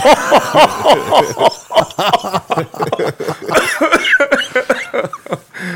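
long, high real laughter; laughing hard at his own story. Real, not acted.

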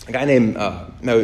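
usual speaking voice

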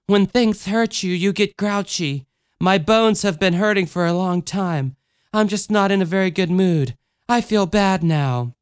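sulky small child, voiced not whispered; grouchy child. A narrator's reading. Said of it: "she whispered to him".

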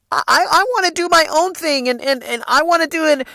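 high-pitched mock sister voice; stubborn sister. Voicing his sister's stubborn objection.

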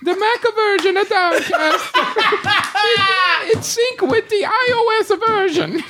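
put-on very high, horrible Italian accent; horrible mock accent. Host giving in mid-ad, horrible Italian accent. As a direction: do a badly faked cartoon Italian. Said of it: "then did that horrible accent".

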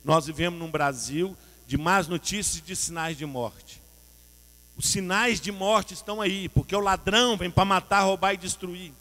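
loud, building list of warnings; full-force preaching. Acted.

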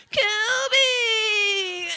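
Kobe yelled high and loud; mock Kobe yell. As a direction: bellow Kobe like a showboating kid. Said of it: "Boom, boom, boom".

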